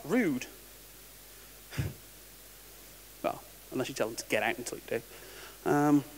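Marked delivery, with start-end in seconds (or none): none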